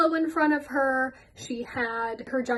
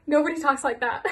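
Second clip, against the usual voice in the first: ordinary talk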